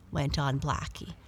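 usual speaking voice

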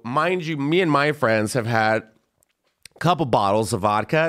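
usual speaking voice

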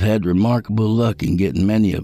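somber first-person narration; story narration. Acted. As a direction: read it like a confession.

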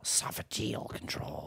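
breathy hushed repeat; whispered. Pretend. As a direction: whisper it back, savoring the word. Repeats word in hushed mock tone.

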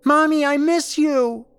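put-on loud, piercingly high little kid; high-pitched child's cry. A narrator's reading. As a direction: call out for Mommy like a small child. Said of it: "this time with a much higher voice".

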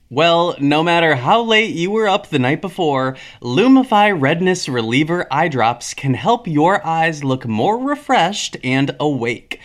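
upbeat sponsor read, fast at first. Acted. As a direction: sell it like a podcast ad.

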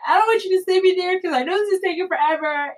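high, rushed imitation of tearful pleading; retold crying, fast and high; bawling. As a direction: re-enact a scared plea in a hurry. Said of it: "I just start bawling, crying".

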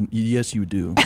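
ordinary talk

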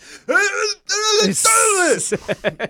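loud wordless slurred yell; garbled high bellow, hammed up; Sylvester Stallone. Parodying an action star, garbled Stallone yell. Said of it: "That's my Sylvester Stallone impression".

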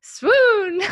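high, slow swooning belle; Southern Belle. Pretend. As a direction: swoon it like a drama queen. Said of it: "pretend to be, like, the Southern Belle".

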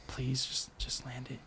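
hushed plea. Retold or imitated. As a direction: beg it under your breath like a prayer. Praying he lands the trick.

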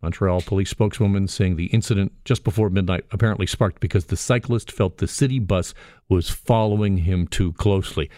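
ordinary delivery

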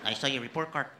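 put-on light Mexican accent, proud dad. Mexican father praises his son's report card, in a light Mexican accent. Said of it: "I'm like".

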